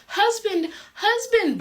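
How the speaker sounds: a frantic high call into the empty night. Acted. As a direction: cry out after him as he leaves. Psyche calls after him.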